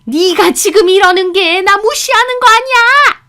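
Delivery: very high, loud angry yell; sudden shriek. A narrator's reading. Mother-in-law insisting she is being ignored, shrieking. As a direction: shriek the accusation. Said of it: "소리를 빽 지르시는 겁니다".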